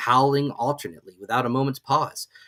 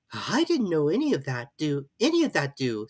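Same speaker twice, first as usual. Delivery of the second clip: polite; 4 semitones higher than usual. A narrator's reading. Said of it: "said Alice very politely".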